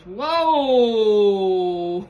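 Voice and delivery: sudden loud laughing whoa; jolted by the flame leaping up; startled whoa. Real, not acted. Lighter flares up unexpectedly, loud startled whoa.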